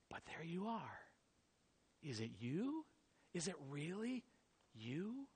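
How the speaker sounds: soft, breathless disbelief; hushed wonder; awed whisper. Acted. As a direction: whisper in awe at the sight. Awed recognition of Jesus.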